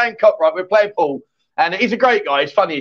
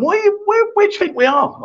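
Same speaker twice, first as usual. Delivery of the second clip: incredulous; 14 semitones higher than usual. Real, not acted. Incredulous at viewer's fishing question.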